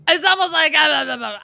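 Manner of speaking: loud, high blabbering mimicry. Mocking a contestant's odd accent, loud high blabber. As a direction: blurt nonsense syllables, high and loud. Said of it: "like, a [name], like, to his voice".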